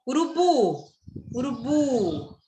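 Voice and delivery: "Urubu" with a long final BU; slow teaching demo, drawn-out BU in "Urubu"; stress on BU. Shown as a demonstration. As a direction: lean on the last syllable of "Urubu" and hold it. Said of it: "a sílaba tônica é bu".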